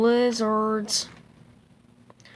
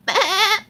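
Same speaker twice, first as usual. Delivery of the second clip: short high goat bleat; goat bleat attempt; 9 semitones higher, 8 dB louder than usual. Child reading a picture book, trying a goat bleat. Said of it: "I can try to do, like, a goat".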